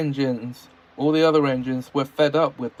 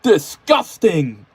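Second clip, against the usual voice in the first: disgusted James voice; 5 semitones higher, 8 dB louder, slower than usual. A narrator's reading. James scolding Percy.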